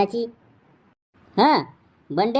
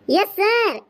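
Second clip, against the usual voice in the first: high, prim yes sir; a schoolgirl answering the roll call; girl's voice; 6 semitones higher, much slower than usual. Acted.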